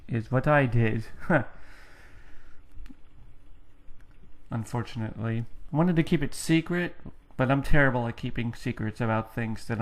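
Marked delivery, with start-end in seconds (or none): 1.1-4.5 soft breathy laugh. Real, not acted. Laughs at his own admission.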